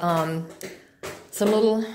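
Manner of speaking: ordinary talk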